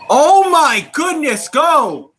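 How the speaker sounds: thrilled, stretched-out cheer; excited. Real, not acted. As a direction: cheer the hit on. Watching a big hit live.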